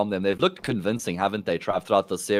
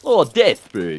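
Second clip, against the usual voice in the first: strong South African accent; 5 dB louder, much slower than usual. Shown as a demonstration. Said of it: "say death in your strongest South African accent".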